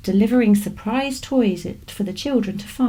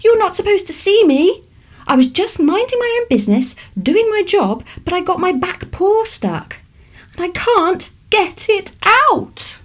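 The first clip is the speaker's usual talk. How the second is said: high, small, fretting rabbit voice, louder; a fussy little rabbit stuck and worried; 10 semitones higher, 4 dB louder than usual. Acted. Worried rabbit explains, stuck paw.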